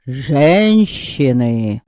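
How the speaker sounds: drawn-out dramatic cry; exclaiming. A narrator's reading. Pan Zusek cries out to the hall, slow and dramatic. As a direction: stretch the word out like a stage orator. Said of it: "воскликнул пан Зусек".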